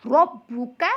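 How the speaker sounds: "रब्बुका" begun with a round "रॉ"; thick rounded R. Shown as a demonstration. Teacher shows how to read it, "रब्बुका" with heavy र. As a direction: make the र of "रब्बुका" full and rounded. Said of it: "तो फिर इस तरह पढ़ेंगे".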